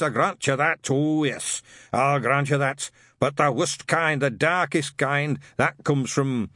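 put-on a suspicious old witchfinder ranting; gruff old sergeant. A narrator's reading.

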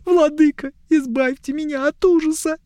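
put-on high, pleading young elf; whiny elf. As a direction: beg high and tearful. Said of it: "слышались плаксивые нотки".

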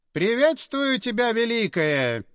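stately and solemn; stately greeting. A narrator's reading. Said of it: "степенно промолвил главарь".

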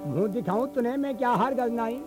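ordinary delivery

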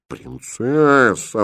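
aghast, stretching the word out; horrified whisper. Acted. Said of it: "в ужасе прошептала". (в ужасе, протяжно)

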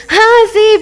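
high, put-on eager yes; outwardly agreeable. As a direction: agree a little too brightly, masking reluctance. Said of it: "por dentro".

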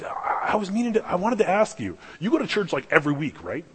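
high, halting, curious asking; tentative, genuinely curious; sincere question. Retold or imitated. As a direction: imitate a man working up to a question. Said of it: "it wasn't sarcasm".

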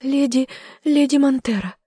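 high, frightened confession; blurted. A narrator's reading. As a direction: blurt the name out in fear. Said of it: "выпалила на одном дыхании".

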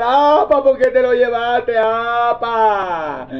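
wailing lament for a dead father; hammed-up graveside wailing; mock wailing mourner. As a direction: cry it out like a hired mourner. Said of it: "la función de esa persona en el velorio es llorar y gritar".

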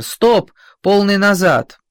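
loud high barked command; officer shouting orders. A narrator's reading. Officer yells to driver, panicked loud order. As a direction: shout full reverse in panic. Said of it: "кричит офицер".

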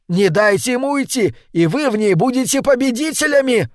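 high, urgent cry climbing to the end; rally the newcomers to stop a fugitive; excited high-pitched urging; calling out. A narrator's reading.

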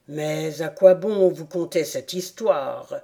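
a small creature complaining it's been interrupted; low, grumbling rat; peeved rat. A narrator's reading. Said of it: "s'interrompit ici le rat".

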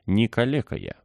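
a touch of indignant fury; mildly enraged denial; furious exclamation. A narrator's reading. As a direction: protest it hotly but contained. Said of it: "в бешенстве воскликнул он".

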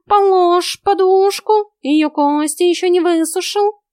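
cat backs off from the pillow; alarmed cat voice. A narrator's reading. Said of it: "попятился наглый зверь".

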